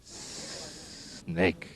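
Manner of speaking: a soft, hissed "Snake" drawn out. Pretend. Teacher hissing the word snake for kids.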